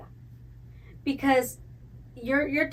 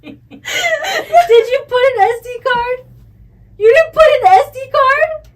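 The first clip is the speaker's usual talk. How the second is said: higher, louder, laughing disbelief; laughing shout; 11 semitones higher, 10 dB louder, slower than usual. Real, not acted. Finding the SD card missing, shouting while laughing. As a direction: shout through real laughter.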